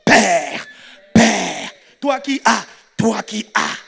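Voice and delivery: loud, falling call to prayer; leading group prayer. Acted. Preacher as Peter giving the believers lines to repeat, loud prayer call.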